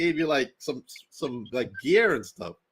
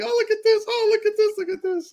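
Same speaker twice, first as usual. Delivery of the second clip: very high, gushing mimicry; imitated excitement. Host mimicking wrestler showing off gifts, excited. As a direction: squeal it like an excited unboxing. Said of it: "I saw you're all excited".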